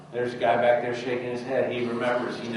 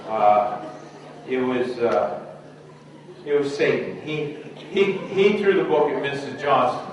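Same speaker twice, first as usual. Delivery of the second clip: louder, higher, halting confession; speaking up; 5 semitones higher, 6 dB louder than usual. Acted. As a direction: blurt the excuse out loud, stumbling. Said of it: "Speak up, I can't hear you".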